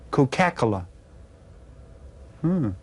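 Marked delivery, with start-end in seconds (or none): 0.1-0.9 Coca-Cola said as one word, co-CA-cola; Coca-Cola misstressed as "co-KACK-ola"; wrong-syllable stress. Shown as a demonstration. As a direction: punch the CA in Coca-Cola and squash the following syllables. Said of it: "when I stress the wrong syllable".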